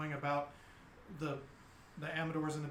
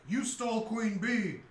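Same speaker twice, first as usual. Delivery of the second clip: lightly voiced giant's roar, a bit higher; a mild bellow in simple giant speech; 4 semitones higher than usual. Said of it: "roars in Giant".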